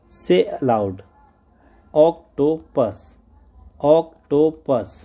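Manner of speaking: very slow, drawn-out octopus; say aloud. Shown as a demonstration. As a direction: stretch out each syllable of octopus. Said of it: "allowed जोर से इसको बोलना है".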